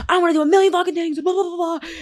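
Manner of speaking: high, fast, breathless rush of ideas; manic idea rush. Retold or imitated. Host mocking herself pitching ideas to team, high and fast. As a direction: gush it out high and quick like a runaway visionary.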